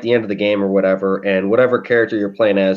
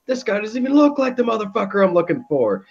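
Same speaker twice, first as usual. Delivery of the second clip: Hitler scorn; 10 semitones higher than usual. Retold or imitated. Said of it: "he's like".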